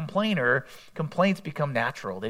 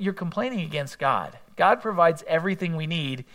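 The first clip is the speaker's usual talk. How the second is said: ordinary talk, nothing marked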